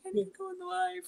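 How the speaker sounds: thin, high and soft plea; high mock plea. Retelling a plea to go on live, high small pleading.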